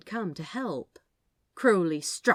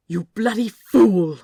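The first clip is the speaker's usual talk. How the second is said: shouting in anger at a fool; furious shout; 4 semitones higher, 13 dB louder than usual. Acted. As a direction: bellow it at full volume. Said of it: "Aziraphale shouted".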